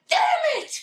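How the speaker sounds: very high, loud mock outburst; frustrated yell. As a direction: yell it in comic exasperation. Retold frustration, stuck in Mexico.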